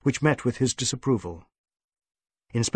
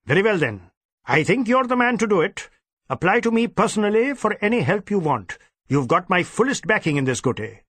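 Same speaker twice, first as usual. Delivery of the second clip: pompous superintendent; 7 semitones higher than usual. Acted. Superintendent briefing his inspector, pompous and stern. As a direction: lay down the orders with self-importance. DSP voiced.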